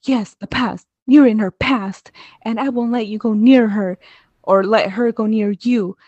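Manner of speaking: mock-angry confrontation, emphatic and high; mad. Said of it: "fighting or, you know, mad".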